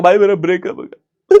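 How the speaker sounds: a whiny fake sob over a breakup; half-hearted blubbering friend, voice cracking up high; hammed-up heartbroken wail; mock crying friend. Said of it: "कोई दोस्त बोलेगा".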